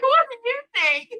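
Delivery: high, loud exasperated cry; mock indignant yelp, bawling; sarcastic outburst. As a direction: fling it back, high and loud. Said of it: "I'm like".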